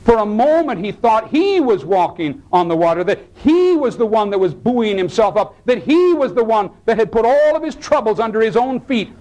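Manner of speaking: high-pitched, driving sermon delivery; impassioned preaching. Real, not acted. Preacher explains Peter's mistake to the congregation, impassioned preaching. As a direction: preach it with rising fervor.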